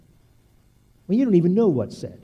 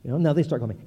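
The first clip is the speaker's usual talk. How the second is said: ordinary delivery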